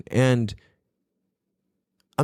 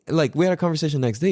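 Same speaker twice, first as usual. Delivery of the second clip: ordinary talk, nothing marked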